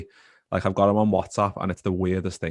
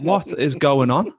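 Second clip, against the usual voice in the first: slow, higher baffled "what is going on"; 6 semitones higher, 5 dB louder, slower than usual. Retold or imitated. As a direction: drag it out, half disbelieving and louder. Puzzled at a missed call.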